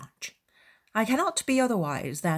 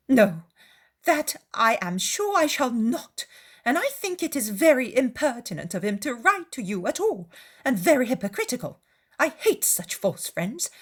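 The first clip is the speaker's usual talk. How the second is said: higher, louder, fussing mother; indignant Mrs Bennet; 6 semitones higher, 4 dB louder than usual. Acted. Wife refusing to be softened by the letter, indignant. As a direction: protest crossly as an offended wife, pitched up.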